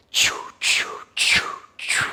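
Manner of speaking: sharp, loud whispered "choo" bursts; fast and furious choo. Shown as a demonstration. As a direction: blast out each "choo" hard. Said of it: "kind of fast and furious".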